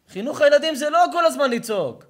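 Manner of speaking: loud, high-pitched sermon delivery; fiery preaching. Acted. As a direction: preach it hard to the crowd. Preaching at full force.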